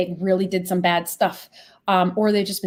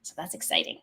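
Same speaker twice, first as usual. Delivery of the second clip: ordinary talk, nothing marked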